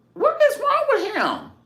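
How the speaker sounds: high, loud, incredulous squeal; sky-high exasperated cry; exasperated shriek. Real, not acted. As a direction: shriek it in disbelief. Exasperated outburst.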